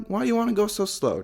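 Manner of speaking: ordinary talk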